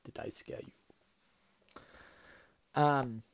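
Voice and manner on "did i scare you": whispering. Pretend. Teasing after the boo.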